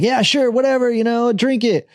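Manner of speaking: high-pitched, offhand go-ahead; imitated carefree partygoers. Friends waving him on with the bottle, high and offhand. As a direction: lightly mimic easygoing party guests. Retold partygoers agreeing to drink.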